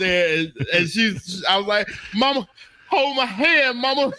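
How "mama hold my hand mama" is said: high, hammed-up plaintive plea; retold dying plea. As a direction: beg mama like a mock deathbed scene. Retelling dying plea to his mother.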